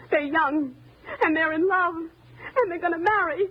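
high, emotional protectiveness; high voice, slowing as emotion overcomes it; tearful defence. Acted. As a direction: plead their case through tears. Pleads young lovers' case.

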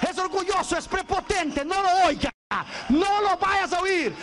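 high, insistent begging; acted frantic plea.

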